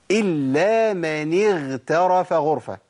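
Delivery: slow recitation of اغترف with a voiced gh; correct ghayn. Shown as a demonstration. Teacher showing the verse said right, slow careful recitation. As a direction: draw out إلا من اغترف غرفة, keeping the gh voiced. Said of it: "أنا قلتها صح كده".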